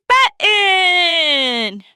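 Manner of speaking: the big finish, shout button. Shown as a demonstration. Teacher counting up to a shouted button. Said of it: "button up your coat like this".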